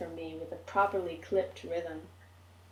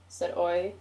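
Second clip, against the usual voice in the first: ordinary delivery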